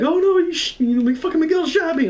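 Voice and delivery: high-pitched mock outcry as the shot friend; imitating upset friend. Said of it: "Louis was like".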